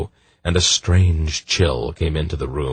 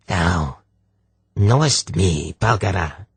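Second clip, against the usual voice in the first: put-on halting, rasping voice with a strange accent; harsh rasp, peculiar accent; 3 semitones higher than usual. Possessing sorceress answers Polgara, harsh rasp in a peculiar accent. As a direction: rasp each word apart in an odd accent. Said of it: "in a harsh, rasping voice".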